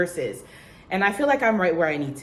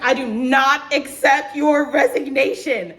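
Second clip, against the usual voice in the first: loud, slow, higher-pitched mock refusal; upset supervisor; 6 semitones higher, 6 dB louder than usual. Supervisor refuses her resignation, loud slow mock upset. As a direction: imitate a boss dramatically refusing, drawn out. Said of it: "my supervisor was so sad".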